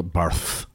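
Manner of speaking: Barth ending in a soft "th"; lisped "th". Shown as a demonstration. Showing co-host the Spanish sound, lisped Barth. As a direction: say Barth as if lisping. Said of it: "like you've got a lisp".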